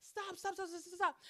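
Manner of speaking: high pleading stop; grandma pleading. Retold or imitated. Grandma breaking up the fight, high pleading. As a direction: beg them to stop like a worried grandma. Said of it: "she's like".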